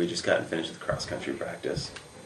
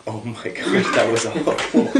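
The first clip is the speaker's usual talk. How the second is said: louder, higher, through laughter; mortified buddies, laughing; 4 semitones higher, 5 dB louder, slower than usual. Retold or imitated. Buddies who saw the rejection, mortified and laughing. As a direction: imitate appalled friends while cracking up. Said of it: "they were just mortified".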